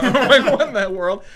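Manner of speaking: high, loud laughing disbelief; giggling disbelief at a barmaid job. Real, not acted. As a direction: laugh through the words.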